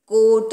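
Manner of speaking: कोट with the long O drawn out slowly; long vowel demo. Shown as a demonstration. Teacher to children showing the long O in कोट, drawn out. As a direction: stretch the O in कोट to show it is long. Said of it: "long है".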